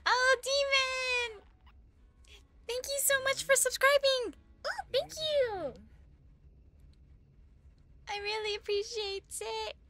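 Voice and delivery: overjoyed thanks. Real, not acted. Streamer thanks Demon for subscribing, overjoyed squeal. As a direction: squeal with gratitude, stretching the words.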